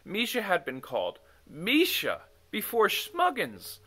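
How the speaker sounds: usual speaking voice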